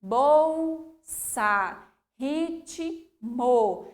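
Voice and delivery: "Bolsa", "ritmo" with the split consonants stressed; emphatic consonants. Shown as a demonstration. Teacher stressing the separated consonants. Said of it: "com ainda mais ênfase".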